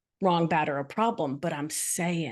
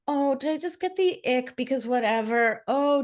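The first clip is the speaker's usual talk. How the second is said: mock self-doubt; 6 semitones higher than usual. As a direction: play the anxious inner voice questioning yourself. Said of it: "it's not like".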